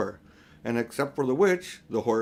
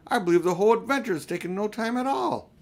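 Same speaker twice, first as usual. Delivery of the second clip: put-on boyish voice pitched much higher; Diggory amazed; 8 semitones higher, 3 dB louder, faster than usual. A narrator's reading. Diggory tells Polly, amazed, in a high boyish voice. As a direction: marvel at it as a young boy. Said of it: "said Diggory".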